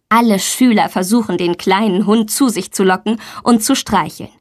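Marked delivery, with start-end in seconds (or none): none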